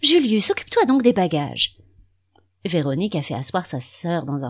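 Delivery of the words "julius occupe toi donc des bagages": high, loud impatient command; peevish order. Acted. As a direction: bark the order at him. Wife orders husband sharply.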